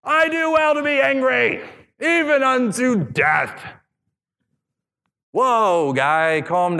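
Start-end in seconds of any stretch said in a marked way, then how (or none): 0.0-3.6 over-the-top defiant cry, pitched high and slowed at the end; dramatic Jonah. Retold or imitated. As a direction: bellow it like a pouting prophet. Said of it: "And what does Jonah say?".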